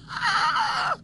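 high shriek into a laugh; shriek, then laugh it off; scream then laugh. Retold or imitated. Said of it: "And I'm gonna be like".

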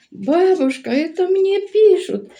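granddaughter's voice. Retold or imitated. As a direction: mimic the excited little girl. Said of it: "Я говорю". (онука радісно вигукує високим дитячим голосом)

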